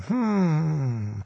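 soft, drawn-out thinking hum; stalling hmm. Retold or imitated. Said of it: "to stall for time".

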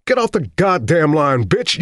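furious shout. Acted. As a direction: scream at the operator. Cursing operator.

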